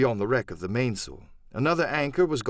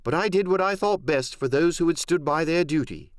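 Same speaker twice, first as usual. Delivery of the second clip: a lightly raised, earnest doctor; doctor's voice; 5 semitones higher than usual. Acted. Doctor answering Silver, firm and earnest. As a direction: defend his choice firmly. Doctor's quoted speech ends.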